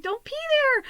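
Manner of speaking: squeaky, high begging no; high pleading warning. Retold or imitated. To the dog about to pee indoors, high pleading. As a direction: beg the dog not to pee there.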